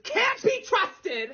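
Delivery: high, very slow, dragged out; dramatic drawn-out complaint. Acted. Vlogger complaining about her cats, slow and dramatic. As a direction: stretch every word in mock despair.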